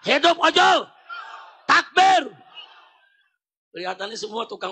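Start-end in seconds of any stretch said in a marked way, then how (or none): 0.1-2.3 high, loud slogan to the crowd; rallying shout. Real, not acted. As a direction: shout to rouse the whole crowd. (seruan lantang penyemangat massa)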